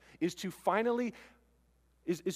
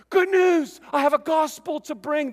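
high, much louder joyful announcement; excited messenger; 8 semitones higher, 9 dB louder than usual. Acted. Messenger calling out good news to the village, excited. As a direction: burst in with the news.

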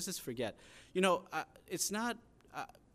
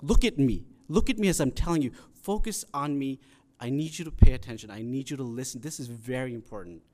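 acted urgent parent, louder at the start; urgent pleading; 10 dB louder than usual. Parent wanting the kids to listen, urgent and insistent. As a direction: press each demand on a child, loud then easing. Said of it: "there's this urgency in his voice".

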